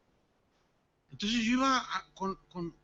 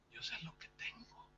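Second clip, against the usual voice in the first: much softer, whispered secret; 5 semitones lower, 15 dB quieter than usual. Retold or imitated. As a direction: confide it in a whisper. Whispering to doctor friend in exam.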